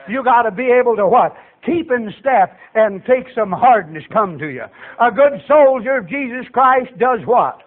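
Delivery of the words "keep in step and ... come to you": usual speaking voice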